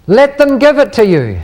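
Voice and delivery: key sentence delivered with a lift. Shown as a demonstration. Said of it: "the follow-up sentence is".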